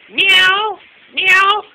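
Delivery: high loud mock meows; imitating a cat. Retelling the teasing outside, loud high meows. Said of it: "I was sitting out there going".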